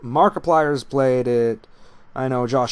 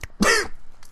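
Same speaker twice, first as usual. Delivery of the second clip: harsh coughing fit. Real, not acted. Coughing after wasabi on tongue.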